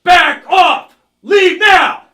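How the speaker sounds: loud, high red alert shout. Shown as a demonstration. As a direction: yell them back off you. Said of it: "you're gonna get louder".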